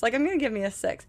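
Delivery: ordinary delivery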